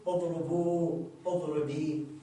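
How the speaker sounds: slow اضربوا on long oo, اضربي on long ee; pausal forms, long vowels restored. Shown as a demonstration. Teacher shows both stopped forms, long vowels restored. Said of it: "ويرجع واو الجماعة يرجع".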